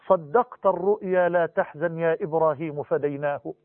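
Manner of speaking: 낮고 부드럽게, 천천히 시를 읊듯이 낭송; recited poem. Acted. Recites a poem softly and slowly.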